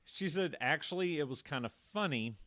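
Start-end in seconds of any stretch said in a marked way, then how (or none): none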